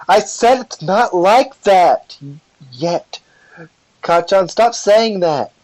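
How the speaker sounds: drawn-out, high-pitched insistence. Acted. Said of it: "gets louder and says".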